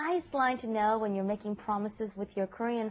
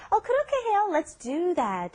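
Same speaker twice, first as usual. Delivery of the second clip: Lisa's reply; 8 semitones higher, faster than usual. Acted. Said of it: "Lisa says".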